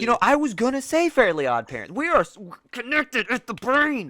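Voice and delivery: loud, high-pitched excitement; excited outburst. Real, not acted. Host delighted co-host picked the same cartoon, excited outburst. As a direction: burst out, pitch swooping up.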